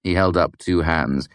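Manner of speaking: ordinary delivery, nothing marked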